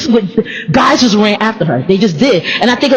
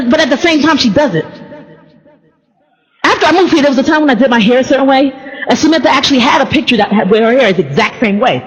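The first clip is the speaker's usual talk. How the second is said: ordinary delivery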